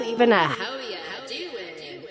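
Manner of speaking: soft, distant echoing hellos; bats' echoing greetings. A narrator's reading. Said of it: "it's followed with a".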